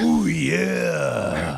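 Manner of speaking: drawn-out wrestler growl, held back; Macho Man Randy Savage. Acted. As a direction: rasp out a wrestler catchphrase. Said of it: "I gave it a Macho Man Randy".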